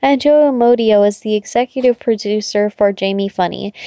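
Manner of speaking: plain reading voice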